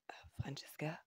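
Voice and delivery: soft, whispery questioning call; hesitant murmur. A narrator's reading. Patient puzzled as she kisses his forehead, hushed and hesitant. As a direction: say the name softly, unsure. Bewildered patient calls her name softly.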